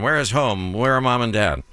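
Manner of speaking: normal talking voice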